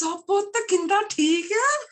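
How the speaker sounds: high, loud, cheery imitation of mum; gushing greeting. Mother answering estranged daughter's phone call, gushing. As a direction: greet your child in a delighted, put-on high voice.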